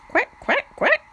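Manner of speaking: high, bright quacking duck; duck quacks. A narrator's reading. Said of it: "flap his wings and call".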